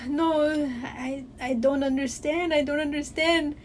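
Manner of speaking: mimicked dad's put-on Mexican accent; mock Mexican-accented English, feigning confusion; heavy Mexican accent. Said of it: "with the heaviest Mexican accent".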